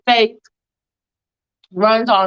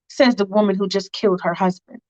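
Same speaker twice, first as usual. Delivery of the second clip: plain reading voice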